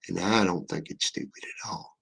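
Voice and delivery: a quick unvoiced whisper, half-joking; whispered aside. Real, not acted. Streamer whispering a cheeky aside to chat. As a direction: slip it in under your breath like a secret. Sarcastic aside after a joke.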